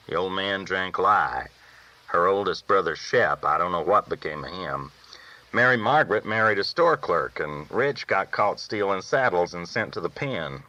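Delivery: ordinary talk, nothing marked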